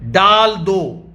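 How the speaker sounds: डाल दो said slowly, each word weighed; slow emphatic. Shown as a demonstration. Preacher teaching the Bible word, slow and deliberate. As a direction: spell out डाल दो deliberately for the congregation. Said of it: "जैसे मैंने गोला खींच रखा है".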